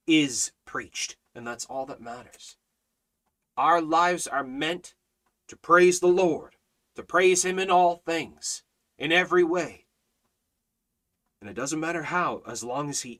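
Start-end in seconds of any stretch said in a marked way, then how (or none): 3.6-9.9 higher, louder exhortation with pauses between phrases; fervent preaching. Real, not acted. Preaches praising the Lord.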